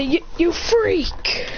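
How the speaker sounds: high, drawn-out taunt; angry jeering. Acted. Villagers driving the demon out, angry.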